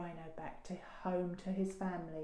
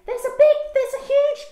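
put-on high, very loud excited child; urgent alarm; 16 dB louder, faster than usual. A narrator's reading. Daisy tries to warn Dad, urgently shouting. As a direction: burst out with it, bigger each time.

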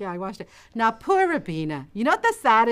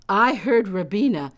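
usual speaking voice

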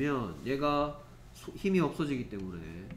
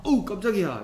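mock startled; 7 semitones higher, 8 dB louder than usual. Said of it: "이럴 만한 얘기를 하겠죠".

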